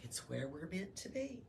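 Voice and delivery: quiet comfort in a dark cave; hushed reading. A narrator's reading. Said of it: "she said".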